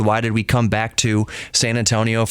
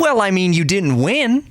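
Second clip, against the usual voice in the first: mimicking dad's blunt put-down, pitched up; savage; 8 semitones higher than usual. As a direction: throw the jab higher, with rising mock innocence. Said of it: "how savage my dad was".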